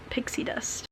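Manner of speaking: breathy whisper to herself. Real, not acted. Reading vlogger whispering the drug's name to herself. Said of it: "repeating the drug name".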